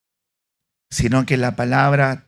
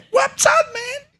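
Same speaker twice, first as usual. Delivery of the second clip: high, strange taunting demon voice; the demon taunts the pretend exorcists in a high, odd voice; mocking demon; slower than usual. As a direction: sneer at them as the demon.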